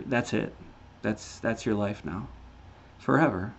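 solemn warning fading to near silence. Real, not acted. Preaching eternity fading quietly.

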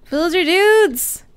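loud, high, slow call to the villagers; "Villager dudes!" called loud and high, stretched out. Real, not acted. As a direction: sing out to get their attention. Calling villagers again louder.

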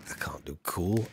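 low, soft and slow, then a whisper on cool; softly admitting he can't be cool; self-deprecating mumble. Real, not acted. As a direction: mutter it, shrinking away. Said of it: "I'm not cool".

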